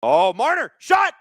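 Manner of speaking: sudden high yell on the shot; excited shout. Real, not acted. Streamer yelling at a live shot, excited. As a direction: blurt it out as the puck flies.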